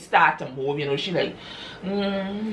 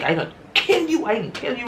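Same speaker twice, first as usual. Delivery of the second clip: much higher, strained threat that fades; furious threat; 7 semitones higher, faster than usual. Retold or imitated. Wife charging at the other woman, high furious threat. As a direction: scream it as she charges.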